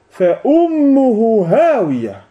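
high, very slow verse recitation; Quranic recitation. Real, not acted. Citation récitée d'un verset coranique. (récitation coranique lente et étirée)

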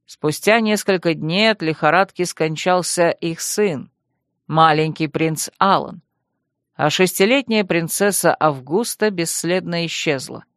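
ordinary delivery, nothing marked

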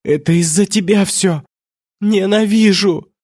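high, hateful outcry; acted hysterical blame; shouted accusation. A narrator's reading. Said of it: "выкрикнула она".

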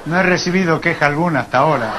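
deep put-on voice. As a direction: fire it off low like a stuffy old doctor.

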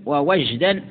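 ووجدا with a short a, "wa-wajdan"; second waw of ووجدا opens on "wa"; a vowel on waw. Shown as a demonstration. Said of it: "مثلث الواو".

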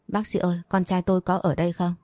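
plain reading voice

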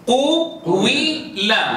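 قو-وي-لا with the u sound drawn slowly into i, each syllable stretched; slow drawn-out ishmam. Shown as a demonstration. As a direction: glide slowly from u to i in قو-وي-لا. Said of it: "ما أصل قيل؟". (نطق قو-وي-لا ببطء مع إشمام الضم)

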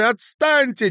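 high, loud cry of protest; angry. A narrator's reading. Woman fending off insistent man, angry. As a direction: shout him away. Acted quarrel.

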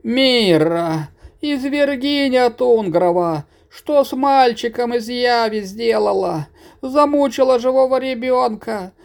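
put-on high-pitched old woman lamenting and scolding; high granny voice, scolding lament; wailing, fussing granny. A narrator's reading. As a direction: wail and fuss over a frozen child. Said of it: "запричитала".

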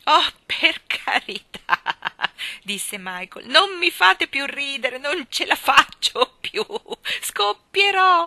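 loud, slow, high words through laughter; about to burst from laughing; choking with laughter. Acted. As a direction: beg them to stop, laughing too hard. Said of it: "quasi soffocando dal ridere".

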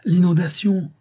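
high, loud warning cry; alarmed cry. A narrator's reading. Maggie waking her hosts to the flood, alarmed high cry. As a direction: raise the alarm, wake the house. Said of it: "Ses cris les réveillèrent en sursaut".

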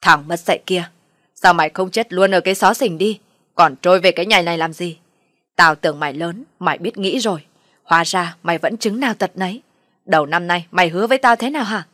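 loud, angry father's tirade; furious shouting. Acted. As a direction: berate the son at full volume. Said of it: "giận dữ quát lớn".

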